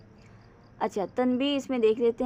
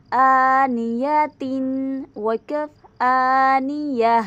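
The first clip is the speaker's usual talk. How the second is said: slow, louder spelling out of letters; spelling drill; 6 dB louder, much slower than usual. Shown as a demonstration. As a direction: spell each sound slowly for a child. Drills Qaida spelling aloud. (बच्चों को धीरे हिज्जे कराते हुए)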